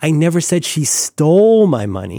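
"stole" pushed as the key word with a falling end; stress on stole. Shown as a demonstration. Host shows how stressing "stole" changes the meaning. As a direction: hit "stole" hard. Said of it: "emphasize any word in that sentence".

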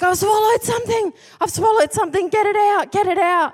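high, loud, frantic plea; panicked shout. Retold or imitated. Sleepwalking husband begs his wife, high frantic shout.

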